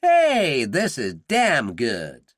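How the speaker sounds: hammed-up Southern Yank enjoying his food; Southern accent. Dillon keeps up his act over breakfast in a Southern accent. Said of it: "Dillon kept up the performance".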